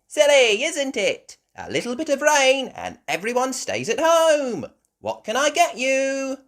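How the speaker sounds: high, loud shopkeeper voice; Miss Rabbit. Acted. Miss Rabbit serving her only customers, high loud voice.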